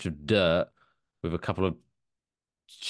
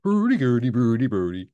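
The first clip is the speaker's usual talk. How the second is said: Swedish Chef; 4 dB louder than usual. Acted. Repeating the gag for the co-host, cartoon Swedish gibberish. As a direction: bounce through cartoon Swedish nonsense. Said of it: "Well, that's the Swedish chef".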